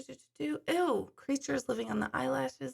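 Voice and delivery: normal talking voice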